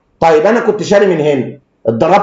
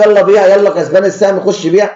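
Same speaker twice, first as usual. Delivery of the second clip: urging to sell; 6 semitones higher, 3 dB louder than usual. Retold or imitated. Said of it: "اقول له".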